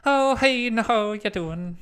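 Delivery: a quick failed Irish lilt; Irish impression. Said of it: "an Irish impression".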